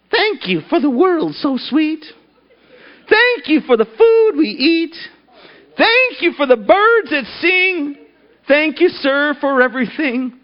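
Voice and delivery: high, slow sing-song housewife praying; nasal sing-song prayer. Acted. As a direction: chant it like a child's grace, high and slow. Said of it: "with this nasally sing-song voice".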